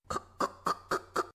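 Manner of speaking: fast whispered heel clicks; high heel clicks. Retold or imitated. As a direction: tap it out like running heels. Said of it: "ハイヒールの音を鳴らしながら".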